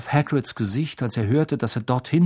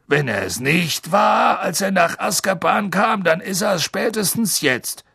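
slow driver; 6 semitones higher than usual. Acted. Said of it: "in seiner langsamen Art".

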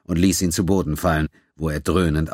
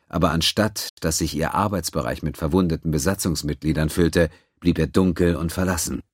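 ordinary delivery, nothing marked

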